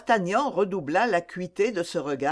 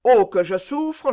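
hammed-up complaint, louder; mock sufferer's lament; 3 semitones higher, 5 dB louder than usual. Captain imitating toothache sufferers, mock lament. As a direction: wail it mockingly, a little raised. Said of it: "Ils me disent".

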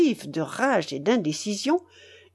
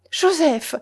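higher, louder call; alarmed cry; 7 semitones higher, 4 dB louder than usual. A narrator's reading. Marquise crying out to the vicar. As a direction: cry his name to stop him. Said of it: "s'écria madame de Raucourt".

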